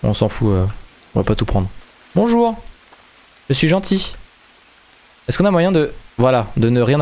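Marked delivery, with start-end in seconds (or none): 2.1-4.1 high, sweet harmless voice; friendly greeting. Pretend. Greeting a stranger in game, sweet and high. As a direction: sound like a harmless nice guy. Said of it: "pour passer pour un mec gentil".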